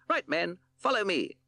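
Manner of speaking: Captain Beaky ordering his band home; shouted command. Acted. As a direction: lead the troops off. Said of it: "he shouted".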